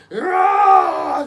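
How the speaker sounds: loud, high imitated roar; child's charging roar. Dad imitating youngest daughter charging him, high roar. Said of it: "my youngest daughter's like".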